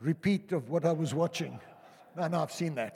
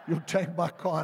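louder amused disbelief, laughing; 2 semitones higher, 6 dB louder than usual. Retold or imitated. Pastor retells refusing to hand over his car, laughing. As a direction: laugh it out in mock outrage. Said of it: "retold protest, laughing".

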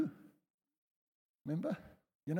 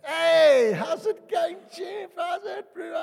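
shouted, much higher, hearty hello; loud cheery greeting; 12 dB louder, slower than usual. Retold or imitated. Arriving friend hails the pastor loudly and cheerfully. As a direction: boom a friendly greeting across the lobby. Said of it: "and they arrive".

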